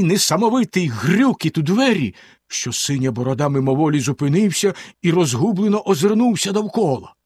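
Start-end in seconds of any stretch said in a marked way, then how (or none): none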